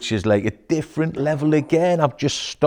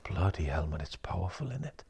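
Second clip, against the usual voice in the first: much softer, low, almost whispered; hushed awe; 5 semitones lower, 13 dB quieter than usual. Retold or imitated. Coach quoting himself after a session, hushed. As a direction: quietly marvel. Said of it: "But you're just like".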